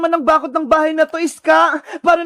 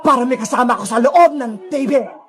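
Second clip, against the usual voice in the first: low, menacing ghost villain; Sadako villain voice; 6 semitones lower than usual. Acted. Sadako explaining to captive Princess, low and menacing. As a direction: confide a dark plan in a low villain voice.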